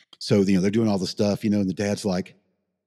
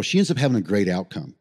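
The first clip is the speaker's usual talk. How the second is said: usual speaking voice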